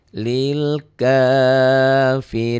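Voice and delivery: slow tajwid chant; Quran recitation. Shown as a demonstration. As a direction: draw the words out like a reciter. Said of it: "dihentikan jadi". (lantunan tartil pelan)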